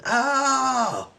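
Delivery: very high, held "ah"; mock wail. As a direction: wail like an overexcited shopper, mockingly.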